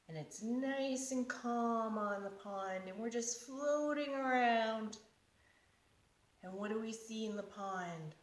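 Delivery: put-on soft calm meditation voice for kids; guided relaxation. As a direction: guide them gently into stillness.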